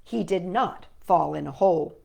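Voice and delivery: ordinary delivery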